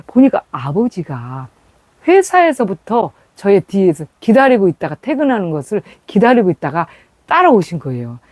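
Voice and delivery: normal talking voice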